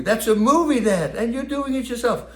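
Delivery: a lightly voiced kid gasping at her dad; daughter's disbelief. Daughter's astonished reply.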